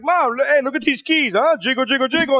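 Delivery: high, sing-song jiggling voice; silly distraction. Retold or imitated. Dad distracting his crying daughter, high and silly. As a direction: dangle the keys like a goofy dad. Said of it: "I just go like".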